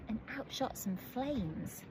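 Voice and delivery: usual speaking voice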